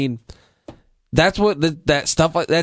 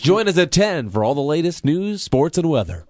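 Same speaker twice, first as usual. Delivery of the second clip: radio announcer voice; 4 semitones higher than usual. Retold or imitated. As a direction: sell it like a cheesy radio jingle. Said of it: "amazing radio voice".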